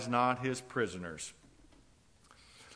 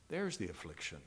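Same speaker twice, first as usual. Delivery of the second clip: low, soft falling "There's the affliction"; subdued repetition; 6 semitones lower, 7 dB quieter than usual. Real, not acted. Preacher lets the affliction settle on the congregation, subdued. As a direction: let "There's the affliction" sink quietly to a close. Same words repeated, sinking.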